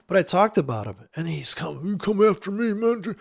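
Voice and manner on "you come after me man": higher, louder mocking tough guy; mock tough-guy threat. Mocking a fan who threatens him, hammed-up tough voice. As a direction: sneer it like an angry fan.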